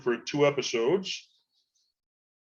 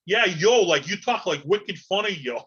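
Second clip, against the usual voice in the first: higher, louder mock New York voice; hammed-up New York accent, pitched up; mock New Yorker; 6 semitones higher, 4 dB louder than usual. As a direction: heckle like a brash New Yorker. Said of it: "all the New York said".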